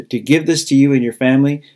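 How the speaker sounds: ordinary delivery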